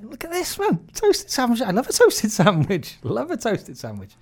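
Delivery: high-pitched, loud delight over pub food; excited enthusing. Retold or imitated. Telling the boys about toasted sandwiches, excited. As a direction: gush about the discovery, easing off at the end.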